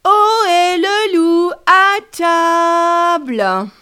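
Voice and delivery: loud, slow, high shout; shouted call. Retold or imitated. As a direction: bellow it out slowly, like calling to dinner. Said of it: "j'ai crié".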